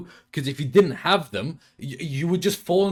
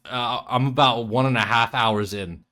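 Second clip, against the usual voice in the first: normal talking voice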